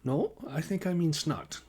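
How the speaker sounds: put-on insistent Nordic-accented retort; Lena Nordstrom. A narrator's reading. Colleague defending her word choice, insistent Northern European accent. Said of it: "Nordstrom countered".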